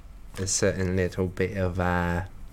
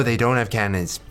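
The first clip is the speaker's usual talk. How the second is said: normal talking voice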